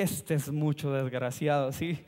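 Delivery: low, slow aside of disgust; muttered mock indignation. Storyteller recalling his thought about the triplet, low mock indignation. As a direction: drop the pitch and draw out the grievance.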